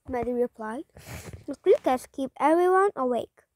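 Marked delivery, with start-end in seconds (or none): none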